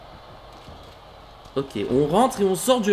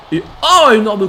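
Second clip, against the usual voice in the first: excited; 4 semitones higher, 9 dB louder than usual. Real, not acted. Huge kick lands.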